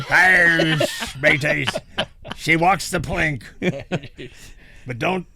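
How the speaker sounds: slow hammed-up pirate growl. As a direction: drag it out like a pirate. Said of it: "Let me do it as a pirate again".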